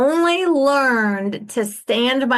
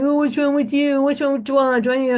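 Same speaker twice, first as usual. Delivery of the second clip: mocking disapproval; 3 semitones higher than usual.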